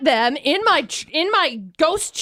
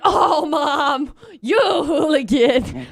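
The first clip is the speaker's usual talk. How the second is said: mock scolding dog voice; much slower than usual. Dog scolding its owner after the prank, slow mock scold. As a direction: drag out hooligan like a fond scold.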